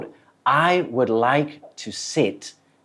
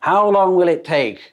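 raised, pushy customer demanding an answer; an unhappy diner pushing the waiter for a time; impatient customer; 4 semitones higher, 4 dB louder, much slower than usual. Acted. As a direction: press the waiter, higher and louder. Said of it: "ลูกค้าก็ยังไม่พอใจ".

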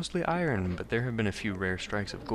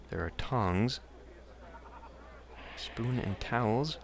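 normal talking voice

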